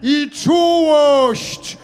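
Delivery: czułość stretched very slow and high; drawn-out call. Acted. Priest calling out czułość from the window, drawn out. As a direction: let czułość ring out long. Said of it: "Będę wzywał uparcie".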